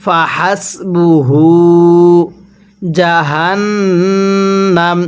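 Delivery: slow, drawn-out tajweed chant; Quran recitation. Shown as a demonstration. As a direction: recite it slowly, stretching the vowels. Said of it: "আমার সাথে টান দেন". (ধীরে টেনে কুরআন তিলাওয়াত)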